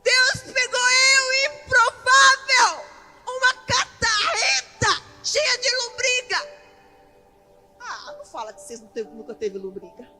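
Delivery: usual speaking voice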